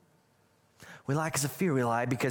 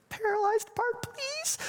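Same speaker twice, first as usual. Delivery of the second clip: mock pleading paralytic; slower than usual. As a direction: squeak out the request like a comic beggar. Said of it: "what it's like to be the guy".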